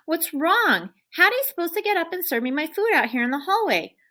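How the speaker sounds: high, loud, put-out puppy voice; whiny dog complaint. Acted. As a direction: protest like a spoiled dog. Dog complains about dinner.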